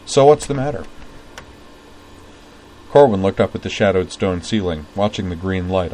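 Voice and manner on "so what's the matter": blunt question. A narrator's reading. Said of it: "asked him bluntly".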